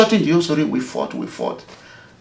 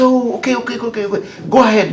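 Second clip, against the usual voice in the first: pitched well up in sudden recognition; a governor caught off guard, quickly friendly; surprised recognition; 9 semitones higher, faster than usual. Retold or imitated. Governor hears who is calling.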